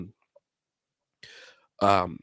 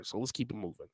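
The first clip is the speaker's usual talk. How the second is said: ordinary talk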